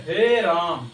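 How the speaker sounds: हे held long before Ram; drawn-out vowel. Shown as a demonstration. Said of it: "हे को बोलते हैं देखो ज्यादा समय लगेगा".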